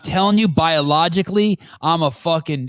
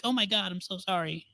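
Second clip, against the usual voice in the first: much softer, cowed little voice; timid, hushed imitation of an apology; meek mock apology; 3 semitones higher, 13 dB quieter than usual. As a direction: imitate someone shrinking back, barely audible. Imitating cowed people apologizing to him.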